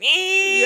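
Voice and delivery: high, loud E.T. squeal; E.T. impression. Retelling a scary film moment, high-pitched alien squeal. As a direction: screech it like a startled alien. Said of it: "pretty good impression".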